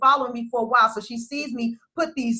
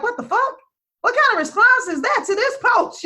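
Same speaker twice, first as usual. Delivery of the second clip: high, incredulous outburst; outraged disbelief; 10 semitones higher than usual. Retold or imitated. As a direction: voice her shocked reaction, pitched way up. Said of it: "I was like".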